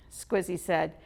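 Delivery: usual speaking voice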